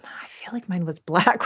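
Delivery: whisper. Real, not acted. Whispered aside about her doll.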